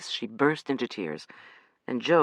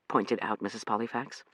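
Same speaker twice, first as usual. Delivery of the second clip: ordinary delivery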